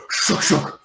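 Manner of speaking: voiceless crunching whoosh; imitated bus noise. Bus runs over the sled in the street, imitated crunch. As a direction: make the sound of a bus running it over. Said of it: "a bus immediately goes".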